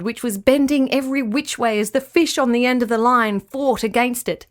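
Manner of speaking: plain reading voice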